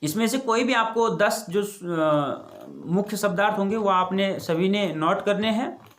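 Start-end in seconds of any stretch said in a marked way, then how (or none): none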